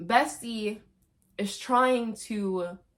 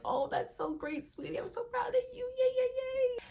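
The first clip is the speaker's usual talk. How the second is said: high, soft, fake-sweet father voice; mock sweet dad; 6 dB quieter than usual. Killer dad cooing to his daughter on the phone, sugary. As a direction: coo it like a fake proud daddy. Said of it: "And he's like".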